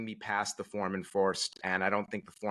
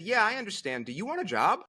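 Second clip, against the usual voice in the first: insistent offer; 10 semitones higher than usual. Retold or imitated. Stranger repeats job offer.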